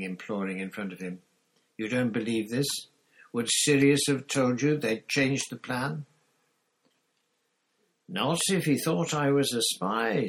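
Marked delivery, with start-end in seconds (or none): 1.8-10.3 high, louder pleading rat-man; pleading Pettigrew. Acted. Pettigrew turns to Lupin and begs, higher and louder. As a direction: beg Lupin, voice climbing.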